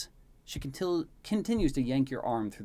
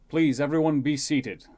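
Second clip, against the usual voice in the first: loud, raised public address; commanding king; 5 semitones higher, 12 dB louder than usual. Acted. King steps forward to seat guests, loud command. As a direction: call out to a full hall with authority.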